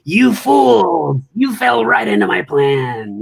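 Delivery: high gloating villain voice; mustache twirling villain. Retold or imitated. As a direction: gloat like a cartoon bad guy. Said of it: "twirling his mustache in that scene where he sort of chuckles".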